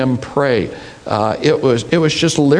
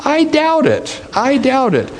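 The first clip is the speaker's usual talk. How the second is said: emphatic doubt; 10 semitones higher than usual. Real, not acted. Emphatic doubt, pitch raised.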